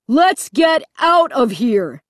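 a contained shout to flee. A narrator's reading. Dad urging the family to escape, shouting. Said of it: "he shouted at the top of his lungs".

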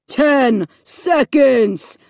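very slow, loud speaker announcement; booming voice. A narrator's reading. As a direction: stretch out each word, booming. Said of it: "the voice boomed".

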